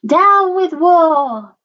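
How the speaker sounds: high, loud, long-drawn hoot. A narrator's reading. Drip protests against war, hooting. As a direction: hoot it out, stretching each word. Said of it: "And he hooted".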